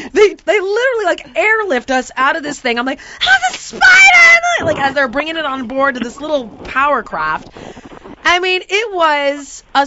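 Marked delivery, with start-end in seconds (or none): 3.2-4.6 slow, high, loud shriek; hysterical scream. Retold or imitated. Woman being airlifted from the spider's canoe, hysterical. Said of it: "I'm hysterical".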